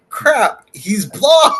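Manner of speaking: high, loud burst of retold astonishment; shocked realization. Telling the co-host about spotting Blob, shocked realization.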